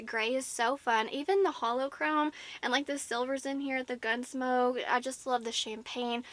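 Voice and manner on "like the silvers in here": ordinary talk, nothing marked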